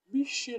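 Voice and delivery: "Miché" loud and clearly sounded out; emphatic syllables. Shown as a demonstration. Teacher showing the last syllable, "Miché" loudly sounded out. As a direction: punch out "Miché" so both syllables are heard. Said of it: "la dernière syllabe".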